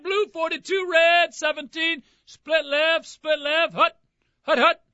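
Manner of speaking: high, loud cadence at the line; quarterback calling cadence. Pretend. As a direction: bark the snap count like a quarterback. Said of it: "lost myself there".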